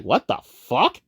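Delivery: rising, baffled disbelief, pitch climbing high; confused Alpha Trion impression. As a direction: play an old robot who can't believe what he hears. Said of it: "that's his impression".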